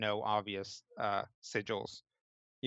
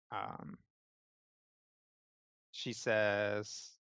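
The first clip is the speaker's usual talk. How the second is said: normal talking voice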